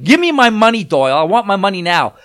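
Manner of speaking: loud, high furious demand; yelling. Retold or imitated. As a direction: bark it at Doyle, wanting the money now. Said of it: "I'm yelling at".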